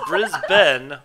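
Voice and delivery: "Brisbane" slow and loud, as BRIZ-BEN; emphatic local pronunciation. Shown as a demonstration. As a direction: hit the second syllable of "Brisbane" as "ben". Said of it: "why is it spelled with an A?".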